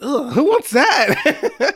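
high, drawn-out disgusted protest. Real, not acted. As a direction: recoil with a slow, high "ugh". Disgust at cold tomato soup.

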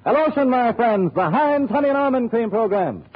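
ordinary talk

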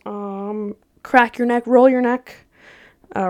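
ordinary delivery

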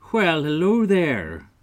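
high, loud, drawn-out hello; hearty storybook little man; jolly leprechaun greeting. Acted. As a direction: pop up with a big slow greeting. Little leprechaun pops up, greets fairies.

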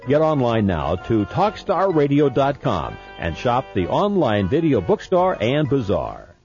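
punchy pitchman ad read; infomercial. Acted. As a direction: sell it like a late-night TV deal. Recorded movie-collection ad read.

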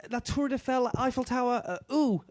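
high, halting attempt at French; fumbling tourist French. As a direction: stammer out the French like a nervous tourist. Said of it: "you can make it".

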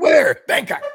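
high, very loud mock shouts; hammed-up shouted call and answer; imitating homie's routine. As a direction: yell question and punchline back to back. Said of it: "he's always be like".

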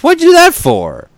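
very high, loud squeaky snail voice; aggrieved snail. Acted. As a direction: squeal it as a tiny indignant snail. Snail's punchline in joke.

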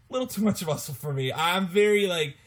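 usual speaking voice

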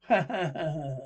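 acted staccato laughter; put-on ha-ha-ha-ha; jeering laugh. Guests laugh at plea for quiet.

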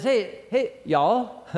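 a light touch of Texan; Texan accent. Acted. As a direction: greet them in a mild mock-Texan drawl. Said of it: "that's my Texan for you".

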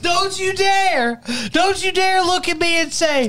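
mock scolding. Hammed-up scolding of a friend over his dropout excuse, high and slow. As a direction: wag a finger in fake outrage.

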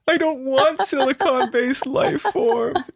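put-on very high, slow child's wail; hammed-up drawn-out crying; mock bawling. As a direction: imitate a sobbing kid's protest. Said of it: "and he's bawling".

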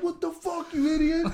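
high, squeaky angry biker; hammed-up road rage; mock biker yell. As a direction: imitate the furious biker. Retold biker yelling at Larry.

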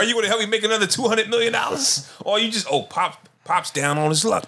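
high, fast mock dad rant; indignant mock dad. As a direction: play the dad demanding help.

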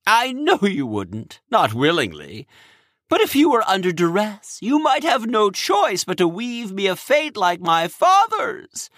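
high, strained worried voice; anxious king. Acted. Cronus anxious voice.

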